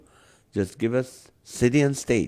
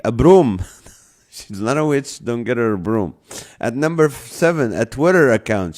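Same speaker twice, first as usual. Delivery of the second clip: loud, punchy top-list announcer; comic countdown read; 3 semitones higher, 6 dB louder than usual. Acted. Host reading a joke Mother's Day list to viewers, countdown announcer style. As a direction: count them off emphatically, punchlines hit hard.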